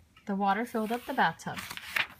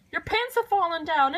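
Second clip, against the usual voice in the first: high, louder shocked lady; scream; 8 semitones higher, 4 dB louder than usual. A narrator's reading. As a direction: shriek it out. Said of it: "the lady screamed".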